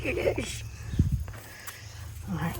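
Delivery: ordinary talk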